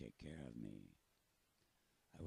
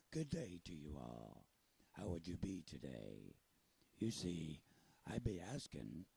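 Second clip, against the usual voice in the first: pirate voice; 5 dB louder than usual. Acted. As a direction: talk like a salty pirate storyteller. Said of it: "Do you think I sound like a pirate?".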